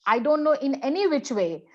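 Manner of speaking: ordinary talk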